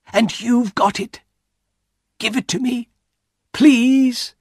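high, urgent begging; pleading. A narrator's reading. Wife begs husband to give it to her, pleading. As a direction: build to an insistent please.

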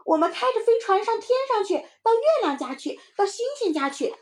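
put-on very high, excited kid; eager child cutting in. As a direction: jump in before your friend can. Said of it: "毛毛抢着说". (小孩抢着高声说)

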